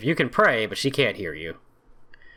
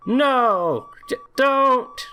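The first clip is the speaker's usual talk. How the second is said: panicked scream; 13 semitones higher, much slower than usual. Acted. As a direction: scream as if watching someone die. Voicing character's panicked screams.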